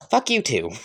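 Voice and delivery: high-pitched retort; cheeky comeback. Acted. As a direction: throw the insult back high. The insult is returned.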